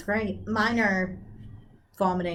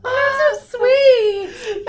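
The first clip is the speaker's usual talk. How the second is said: high, tearful, very slow thanks; crying; 5 dB louder, much slower than usual. Real, not acted. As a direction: cry while thanking. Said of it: "I'm sorry, I'm crying".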